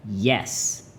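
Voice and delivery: "Yes" short with a falling tune; angry tone. Shown as a demonstration. Teacher demonstrating fourth tone, "Yes" falling, mildly angry. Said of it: "angry tone".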